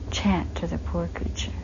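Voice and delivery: very soft, hushed and pitying; quiet, compassionate instruction, voiced for someone else; retold tender compassion. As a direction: gently urge care for a helpless creature. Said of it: "look of tremendous compassion".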